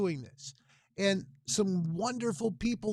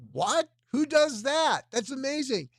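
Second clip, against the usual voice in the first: much higher and louder, astonished outburst; amazed disbelief; 8 semitones higher, 7 dB louder than usual. Retold or imitated. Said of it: "I was like".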